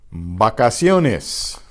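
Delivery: very slow Vacaciones starting with a B sound; Spanish V as B. Shown as a demonstration. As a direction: say Vacaciones slowly, V like B. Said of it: "V ... pronounced like the B".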